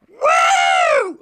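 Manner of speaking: shrieked high woo, arms up; mock party woo. Comic imitating a heckler's piercing woo. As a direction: imitate the drunk lawyer's woo. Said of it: "And it just, uh".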